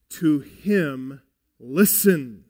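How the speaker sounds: "to him listen" said slowly with "him" and "listen" weighted; slow the Greek order so "him" comes first and "listen" lands last; stress on him. Shown as a demonstration. Preacher showing the congregation the Greek word order, stressing "him". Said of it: "This is emphatic".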